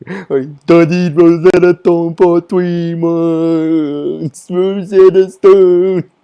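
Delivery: loud, high, very drawn-out put-on voice; hammed-up seething anime fan, stretching every word; seething nerd impression. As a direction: imitate a nerd boasting, high and slow. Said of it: "he's, like, seething".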